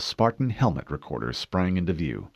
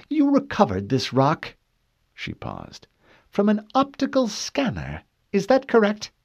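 high, precise questioning woman; cool female interrogator; 14 semitones higher, 4 dB louder than usual. Acted. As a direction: question him coolly.

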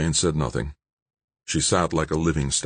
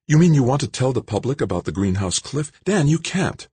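higher, alarmed woman's voice; Jane alarmed; 9 semitones higher than usual. Acted. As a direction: protest in disbelief as Jane. Jane protests.

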